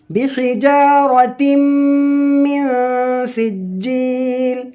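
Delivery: slow, high melodic chanting of the verse; Quran recitation. Shown as a demonstration. Teacher opening the Surah lesson with slow high recitation.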